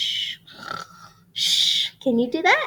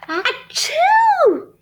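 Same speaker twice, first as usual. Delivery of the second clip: high loud achoo; sudden loud sneeze; big sneeze; 7 dB louder than usual. Acted. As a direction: burst out with a huge sneeze. Bear sneezes in story.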